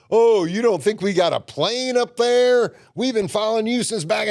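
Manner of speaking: hammed-up know-it-all trooper; smug cop. Retold cop gloating they had a plane overhead.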